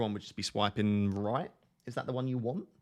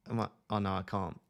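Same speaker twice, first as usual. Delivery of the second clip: usual speaking voice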